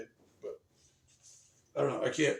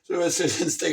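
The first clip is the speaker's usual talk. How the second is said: ordinary talk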